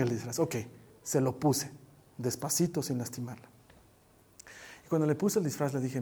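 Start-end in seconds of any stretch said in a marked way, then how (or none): none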